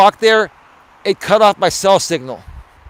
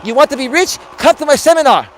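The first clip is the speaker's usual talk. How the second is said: put-on high-pitched accented infomercial guru; put-on immigrant pitchman selling his seminar; Tom Vu; 6 semitones higher, 3 dB louder than usual. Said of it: "guy named Tom Vu".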